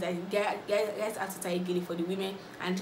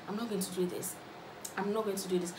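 quiet, held-back repeating to himself; composing himself; 7 dB quieter than usual. Retold or imitated. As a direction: softly talk yourself down. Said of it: "trying to compose himself".

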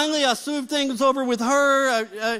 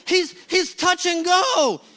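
higher, stammered, drawn-out mock panic; David Griffin; 6 semitones higher, slower than usual. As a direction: imitate a man stuck in panic. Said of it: "gets panicky".

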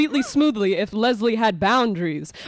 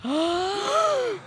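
dramatic gasp; 9 semitones higher, 3 dB quieter than usual. Acted. Narrator gasps at reveal.

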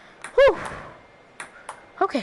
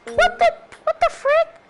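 high, sudden "what the frick"; startled disbelief; 13 semitones higher than usual. Real, not acted. Player startled by the robot's return, high surprised yelp. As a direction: yelp it out, caught off guard. Robot's shot catches him off guard.

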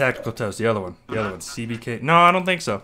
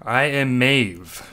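very slow, drawn-out intro; slow announcement; much slower than usual. Pretend. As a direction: say it slowly, like a grand introduction. Self-intro in put-on voice.

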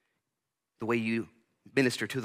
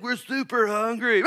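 hammed-up hungry whine, higher and louder; mock starving Israelites; 13 semitones higher, 6 dB louder, slower than usual. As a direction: play it like a starving crowd, overdone. Israelites waking up hungry, acted.

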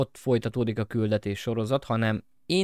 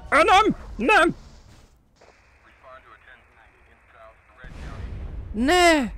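despairing scream; 4 dB louder, much slower than usual. Real, not acted. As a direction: scream it as it all goes wrong. Losing the race. (kétségbeesett sikoltás)

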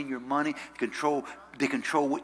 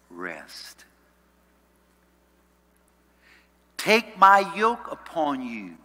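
slow, deliberate scripture reading with long pauses; 3 dB louder, slower than usual. Real, not acted. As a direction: read it out phrase by phrase, letting each hang. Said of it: "I wanna read it slowly".

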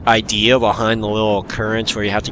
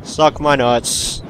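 ordinary talk, nothing marked

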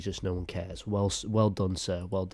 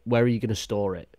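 ordinary delivery

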